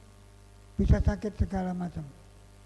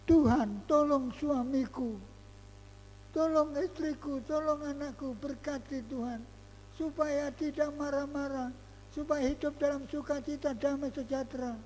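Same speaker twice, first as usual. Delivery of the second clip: demonstrated prayer; 5 semitones higher, 4 dB quieter than usual. Shown as a demonstration. Preacher modelling a prayer for spouse and child, pleading. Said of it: "dibawa dalam doa".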